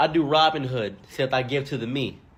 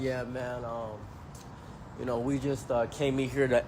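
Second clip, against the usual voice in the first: much softer, hesitant robber voice; sheepish robber mumble; 3 semitones lower, 7 dB quieter than usual. Retold or imitated. Robbers mumbling an excuse to the store owner, sheepish. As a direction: mumble an excuse like a caught thief.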